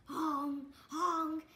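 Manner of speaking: loud honking; goose honk. Shown as a demonstration.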